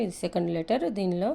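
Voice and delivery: ordinary talk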